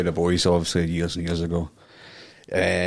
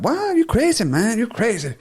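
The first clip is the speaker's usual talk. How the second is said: mock indignant woman; 14 semitones higher than usual. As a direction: play her outraged innocence, pitched way up. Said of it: "She's like".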